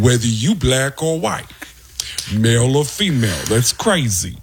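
doing Tracy Morgan for laughs; Tracy Morgan impression. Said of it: "sounds like Tracy Morgan".

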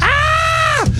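high, whooping laugh that ends in a shout; high whoop of laughter then a cry. Real, not acted. Cackling after quote.

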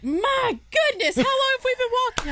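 high, whiny imitation of a tired walker; comically worn-out complaint, high voice; mock exasperation. Retold co-host complaining about walking.

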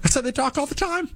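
high sing-song taunt; mocking high voice. Host repeating the line in a mocking high voice. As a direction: tease it in their voice. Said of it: "repeated taunt".

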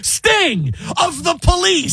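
high, drawn-out shout of disbelief; mock incredulous cry. Host acting out reaction to Sting theory, hammed-up incredulous shout.

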